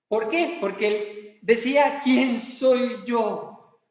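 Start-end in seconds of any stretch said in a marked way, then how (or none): 2.0-3.8 lingering mock lament; very slow. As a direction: stretch every word of "¿Quién soy yo?" in a voice unlike your own. Moses' refrain, slowed right down.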